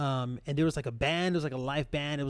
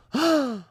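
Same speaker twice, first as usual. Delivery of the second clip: offended gasp; 7 semitones higher, 3 dB louder than usual. Retold or imitated. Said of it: "she goes like".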